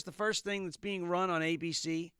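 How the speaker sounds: normal talking voice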